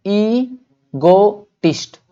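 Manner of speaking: ईगोटिस्ट drawn out as ee - go - tist; syllable-by-syllable. Shown as a demonstration. Teacher shows how to read it, ईगोटिस्ट slowly in pieces. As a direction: say ईगोटिस्ट very slowly with a gap after each syllable. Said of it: "इसको क्या पढ़ेंगे?".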